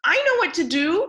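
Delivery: high, fast little bird. A narrator's reading. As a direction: chirp it out brightly like a bird. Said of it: "said the bird".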